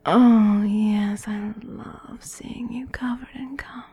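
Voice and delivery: ordinary delivery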